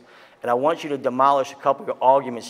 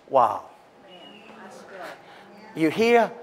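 ordinary talk, nothing marked

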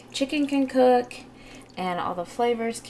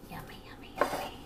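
whispered; 10 dB quieter, faster than usual. Real, not acted. Whispered yummy over food.

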